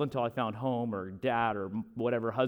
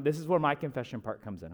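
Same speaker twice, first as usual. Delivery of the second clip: ordinary talk, nothing marked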